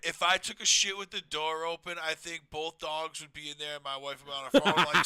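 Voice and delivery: ordinary delivery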